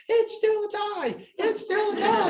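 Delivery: very high, slow mock squeal; drawn-out, high-pitched gleeful cheer; overjoyed girls. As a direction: imitate middle school girls celebrating a tie. Imitating girls celebrating with cartwheels.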